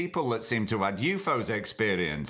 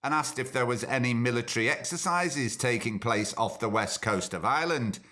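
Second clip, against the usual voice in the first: usual speaking voice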